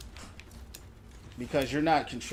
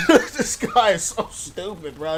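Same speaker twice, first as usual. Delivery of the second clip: laughing scorn; 3 semitones higher, 7 dB louder, slower than usual. Real, not acted. Laughing at the dumb teammate to chat, slow and loud. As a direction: laugh at him as you say it.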